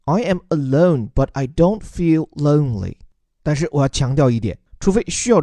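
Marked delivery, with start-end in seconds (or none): 0.1-2.9 slow, clear read of "I am alone, but I don't feel lonely", lean on don't; teacher reads the example sentence slowly for learners; slow model sentence. Shown as a demonstration. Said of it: "叫做".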